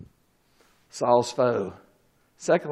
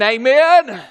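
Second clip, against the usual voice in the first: ordinary talk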